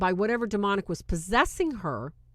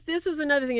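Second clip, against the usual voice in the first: usual speaking voice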